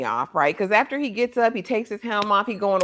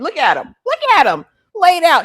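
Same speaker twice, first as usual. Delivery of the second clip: high, loud mock crowing; rising excited taunt; acted gloating; 9 semitones higher, 6 dB louder than usual. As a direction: crow over a beaten opponent. Acting out gloating over fallen fighter.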